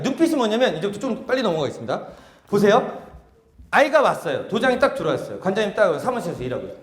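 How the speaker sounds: usual speaking voice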